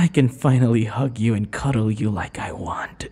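ordinary talk, nothing marked

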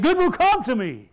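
preacher shouting the line to the crowd; shouted declaration. Shown as a demonstration. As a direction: shout it so the back row hears. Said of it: "you didn't say it like I said it".

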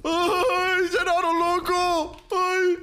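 high panicked shriek. Real, not acted. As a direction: squeal it out in a panic. Sudden scare in game.

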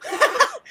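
high cackling laugh; short helpless giggle; burst of laughter. Real, not acted. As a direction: crack up suddenly. Cracking up at what was typed.

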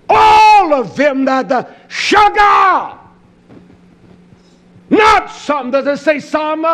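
high, loud, rising to a shout; shouted preaching. Acted. Preacher shouting scripture at the congregation, loud. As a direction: build it up until you shout.

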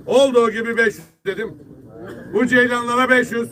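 loud auction call to buyers; auctioneer. Real, not acted. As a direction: fish auctioneer calling the price. Fish market auction calling.

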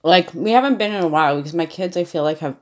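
chewing while talking; muffled closed-lip speech; mouth full of pizza. Real, not acted. Seen 1: Bites slice at -3s; lips closed, chewing, slice in hand throughout.

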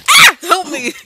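high, loud yelp; panicked cry for help. Pretend. Player calling friend for help in game, panicked yelp.

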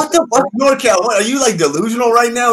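high, loud disbelief at a friend's tall tale; what the hell, are you serious; incredulous outburst. Real, not acted. Cohost reacting to wild tattoo story, high incredulous outburst.

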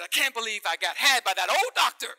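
loud, high furious rant; flaming mad. Acted. Young doctor leaving the office, loud furious rant. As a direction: storm out fuming. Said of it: "flaming mad".

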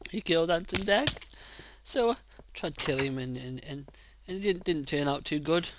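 ordinary talk, nothing marked

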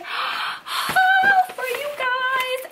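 high, drawn-out squeal of excitement; mock-grand excited reveal; hammed-up announcement. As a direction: stretch it out very slowly and high. Playful announcement of the haul.

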